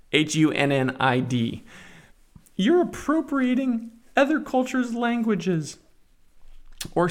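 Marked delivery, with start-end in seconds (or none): 2.6-7.1 very high, whiny-style mimicry; exaggerated high-pitched accusation; mock objector. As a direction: imitate a scolding complainer up high. Mocking an imagined objector.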